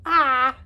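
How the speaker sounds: sudden loud yelp; startled yelp. Real, not acted. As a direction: yelp in surprise.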